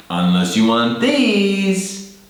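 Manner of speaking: "Unless you want these" slow, "these" rising high. Shown as a demonstration. Teacher modelling conditional intonation to learners. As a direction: draw it out, lifting high on "these". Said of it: "Pay attention to intonation".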